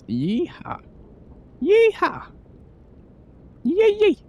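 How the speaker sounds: loud, high Southern cowboy whoop; exaggerated Southern yeehaw yell. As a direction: holler like a rodeo cowboy. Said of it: "I don't know how to do it".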